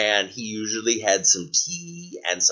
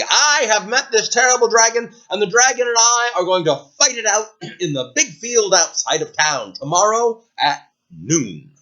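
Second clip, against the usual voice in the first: higher, louder proclamation to the crowd; grand announcement; 9 semitones higher, 3 dB louder than usual. Acted. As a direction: announce it like a town crier. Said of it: "announced to everybody".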